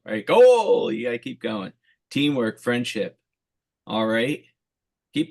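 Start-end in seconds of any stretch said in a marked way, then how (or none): 0.3-0.9 Goal! shouted high and loud like a stadium announcer; celebratory goal shout, much higher and louder; soccer goal call. Shown as a demonstration. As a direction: belt out Goal! like a commentator celebrating a score. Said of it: "You gotta put a little emphasis on that goal".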